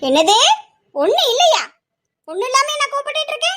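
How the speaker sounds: indignant echo climbing high in pitch; angry. Acted. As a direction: throw the denial back, increasingly worked up. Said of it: "தேவையில்லாம கோவப்பட்டுட்டு இருக்கா". (கோபத்தில் குரல் உயர்த்திக் கேட்பது)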